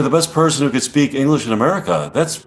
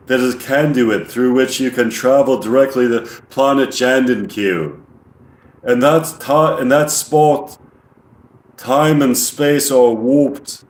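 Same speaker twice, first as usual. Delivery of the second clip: put-on slow, monotone English-accented alien; amateur English accent, deliberate alien monotone. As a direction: imitate an English alien explaining, evenly paced. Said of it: "like, a monotone English voice".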